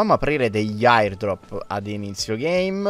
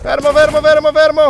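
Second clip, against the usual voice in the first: excited high shouts; alarmed; 14 semitones higher, 5 dB louder than usual. Real, not acted. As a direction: call out in alarm. Dragons arriving, alarm.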